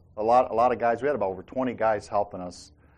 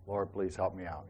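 quiet prayer; 5 semitones lower, 12 dB quieter than usual. Retold or imitated. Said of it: "I said a quick prayer".

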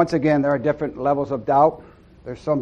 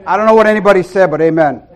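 fast, loud, laughing remark; 4 semitones higher, 11 dB louder than usual. Real, not acted. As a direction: laugh off the moment. Laughs off the horn mishap.

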